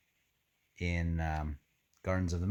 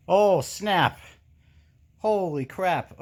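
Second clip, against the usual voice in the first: higher, louder real surprise; shocked reaction; 10 semitones higher, 6 dB louder, slower than usual. Real, not acted. Reader stunned by a twist in the book, exclaiming. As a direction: blurt it out as the twist lands.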